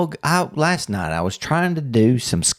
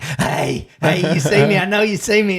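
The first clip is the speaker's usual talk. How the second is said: eager dog voice; 8 semitones higher than usual. Pretend. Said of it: "his tail's wagging like".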